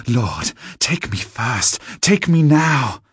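slow, high plea building to a loud cry; desperate rising plea ending in a shout; frantic pleading. A narrator's reading. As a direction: beg the lord to take her first. Woman begs the vampire lord.

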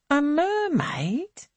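high, slow astonished "A mermaid?"; drawn-out disbelieving question; surprised question. A narrator's reading. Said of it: "repeats Angelica".

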